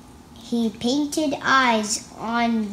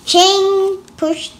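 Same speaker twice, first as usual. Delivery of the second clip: dramatic; 8 semitones higher, 6 dB louder than usual. Acted. Reading dramatically.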